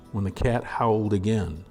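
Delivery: ordinary delivery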